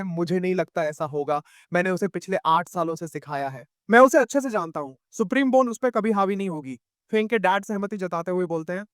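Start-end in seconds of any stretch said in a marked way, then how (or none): none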